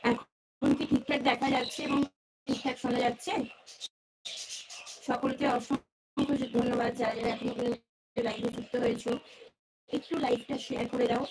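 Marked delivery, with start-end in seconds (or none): none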